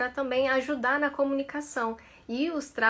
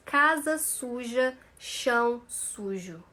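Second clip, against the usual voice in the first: slow, mouth wide on every vowel; open vowels slowly; slower than usual. Shown as a demonstration. Teacher modelling open vowels for the class. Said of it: "Abre a boca na hora de falar".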